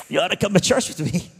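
high, loud, cartoonish stoner friend; hammed-up buddy invite. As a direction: belt the invite like an overeager buddy.

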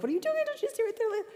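put-on frantic high-pitched cry at a lost child; panicked yell. Mother yelling at her lost son in the store, high panicked shriek. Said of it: "I lost my mind".

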